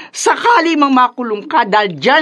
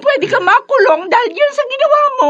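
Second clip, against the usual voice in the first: scolding. Acted. Mother scolds son over stabbing.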